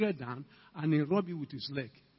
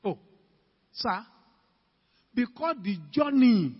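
ordinary delivery

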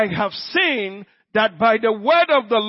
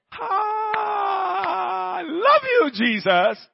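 high, drawn-out cry of adoration; ecstatic cry; 8 semitones higher, much slower than usual. Real, not acted. Preacher crying out love to Jesus, high ecstatic cry. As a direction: burst out in worship. Outburst of devotion mid-sermon.

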